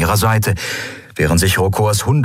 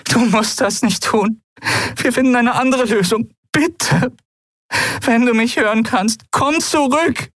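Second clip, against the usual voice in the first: tearful plea. Acted. Endril pleads with Keru to turn back, mildly tearful. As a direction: hold back tears while begging him to return. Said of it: "Sie weinte".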